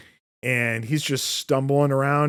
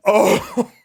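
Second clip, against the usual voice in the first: normal talking voice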